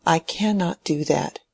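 plain reading voice

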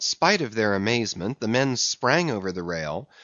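plain reading voice

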